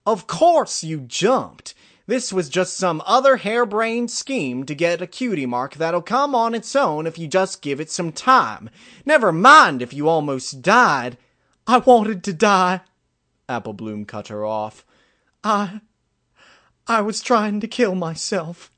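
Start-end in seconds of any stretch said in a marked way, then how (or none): 0.0-11.2 high, loud outburst at a younger sister; worked-up scolding, hitting key words hard; exasperated scolding. A narrator's reading. Older sister scolds Applebloom. 11.7-12.8 high, loud cry of confession; anguished outburst. A narrator's reading. Outburst cutting her sister off. 15.4-18.7 high, loud, halting first word; anguished confession. A narrator's reading. Desperate confession to family.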